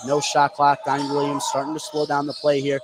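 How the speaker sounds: usual speaking voice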